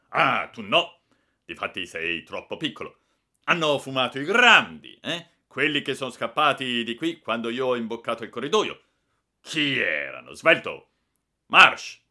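loud, high barking questions; bully the culprit's names out of him; furious interrogation. Acted. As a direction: director demanding who smoked, furious. Director interrogating the boy angrily.

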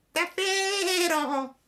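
very high, slow mewling cat echo. A narrator's reading. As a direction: whine it like a stray cat. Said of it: "ripeté il gatto".

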